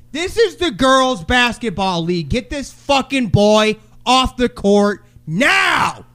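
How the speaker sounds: imagined sideline tirade; acted furious scream. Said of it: "I wanted to scream".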